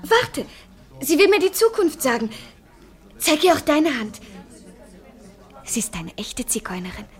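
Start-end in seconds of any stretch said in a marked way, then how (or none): 5.6-7.0 hushed aside, unvoiced; a conspiratorial whisper. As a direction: whisper it so she won't hear. Secret remark about the fortune teller.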